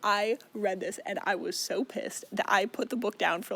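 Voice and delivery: ordinary talk, nothing marked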